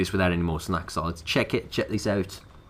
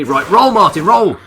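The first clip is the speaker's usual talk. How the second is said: loud rising yell at the character; urgent yell; 6 semitones higher, 8 dB louder than usual. Real, not acted. Gamer yelling at his survivor mid-fight, loud urgent yell. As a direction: shout him through the dodge.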